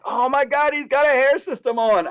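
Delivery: high, startled exclamation; shocked discovery. Retold or imitated. Spotting the lace hairpiece on Brad Pitt in Troy, high startled exclamation. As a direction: gasp it out as if you just caught someone.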